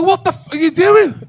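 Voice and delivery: high, loud shocked cry; shocked yelp. Retold or imitated. Patient yelping at the consultant in shock. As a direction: yelp it out, caught off guard.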